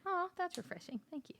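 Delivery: high-pitched little thank-you; reply in a high, light voice, then giggle; retold polite thanks. Thanking a woman who said sorry for grabbing her arm, high and polite.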